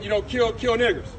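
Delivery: ordinary talk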